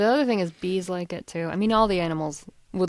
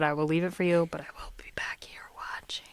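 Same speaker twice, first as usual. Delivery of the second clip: softer, whispered creepy watcher; 4 dB quieter than usual. Pretend. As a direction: whisper it like a lurking host. Creepy chef voice.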